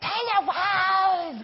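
long drawn-out, high groggly yell; slow, high, silly child's yell; six-year-old's mock accent. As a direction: imitate a child yelling a bad Austrian accent. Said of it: "groggly voice and then just yelling".

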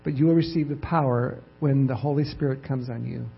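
ordinary delivery

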